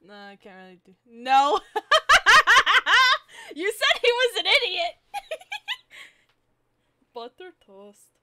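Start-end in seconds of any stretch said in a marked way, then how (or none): 1.6-5.2 high, loud laughing speech; laughing hard. Real, not acted. Cracking up while picking a character's voice, laughing hard. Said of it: "I'm laughing too much". 7.1-8.1 softer, lightly voiced cartoon character; a quiet go at a cartoon voice. Shown as a demonstration. Retrying a cartoon voice after laughing, softly and lightly. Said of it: "Should I make him sound like Ed from Ed, Edd n Eddy".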